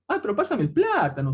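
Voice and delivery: put-on singsong jungle Peru accent; a playful Peruvian selva speaker. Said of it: "tienen un acento un poco así".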